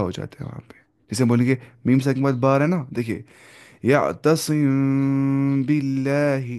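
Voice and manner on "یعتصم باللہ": "یعتصم باللہ" drawn out very slowly, the م of یعتصم hidden in a nasal hum before ب; teacher recites "یعتصم باللہ" slowly for students to copy, long nasal hum on the م; recited with ikhfa. Shown as a demonstration. Teacher models the ikhfa in "یعتصم باللہ" for students, slow nasal hum. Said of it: "دھیان سنیے میری آواز".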